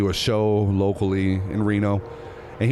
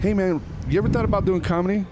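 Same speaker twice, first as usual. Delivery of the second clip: friendly invitation; 9 semitones higher than usual. Retold or imitated. Comedian's friendly question.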